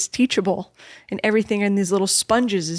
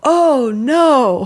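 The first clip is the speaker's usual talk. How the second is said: a hammed-up, higher, louder oh no; mock dismay; 4 semitones higher, 6 dB louder than usual. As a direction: play a child's dramatic letdown.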